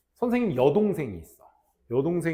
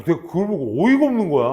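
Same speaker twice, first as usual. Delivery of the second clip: ordinary talk, nothing marked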